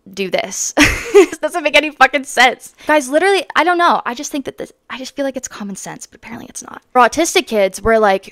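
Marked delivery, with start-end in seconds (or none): 4.1-6.8 whispered, fading to nothing; dropping to a whisper. Real, not acted. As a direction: let it fade into a whisper. Trailing off in frustration.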